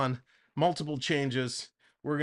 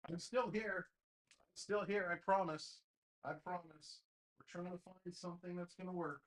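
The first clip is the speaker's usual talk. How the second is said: off-mic, much softer, calling from across the room; far from the mic; 14 dB quieter than usual. Real, not acted. Streamer calling back to chat while changing jerseys, far off mic. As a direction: shout it back from the other side of the room.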